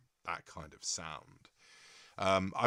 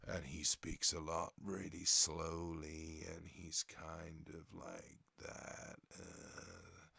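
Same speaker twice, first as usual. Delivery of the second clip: slow, softer, low deadpan comedian drawl; Stephen Wright impression; 4 semitones lower, 7 dB quieter, much slower than usual. Imitating Stephen Wright for the stream, slow softer and lower. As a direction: sink into a flat, beaten-down slow delivery. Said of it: "really down sort of beat voice".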